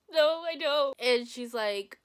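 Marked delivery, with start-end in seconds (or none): 0.1-0.9 louder, higher retort; mock-panicked denial; insistent denial. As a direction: insist it with raised voice. Denying it to her sister.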